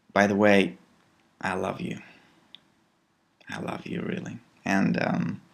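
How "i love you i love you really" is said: soft, almost whispered love; tender self-talk. Shown as a demonstration. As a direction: say it gently to yourself in the mirror. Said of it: "You can say, Okay".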